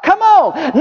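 a lightly acted urgent "come on"; Jesus calling out. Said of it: "Jesus said".

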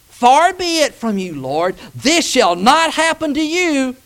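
loud, high, drawn-out scripture rebuke; rebuking. A narrator's reading. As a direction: read Peter's protest slowly and forcefully. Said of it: "took him aside and rebuked".

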